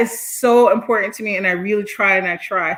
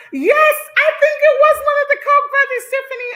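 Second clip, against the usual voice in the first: laughing; 3 dB louder than usual. Real, not acted. Answering Tiffany while laughing.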